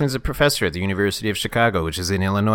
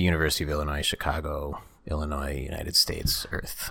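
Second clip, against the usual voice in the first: hushed whisper, trailing lower; 7 semitones lower, 10 dB quieter than usual. Acted. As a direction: whisper it like a secret. Mock-solemn listing, whispered.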